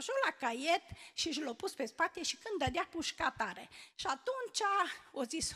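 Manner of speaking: ordinary talk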